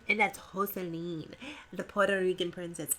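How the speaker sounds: a deliberately bad Spanish accent; horrible Spanish accent. Shown as a demonstration. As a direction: lay on a cheesy Spanish accent for the name. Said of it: "I know that's a horrible Spanish accent".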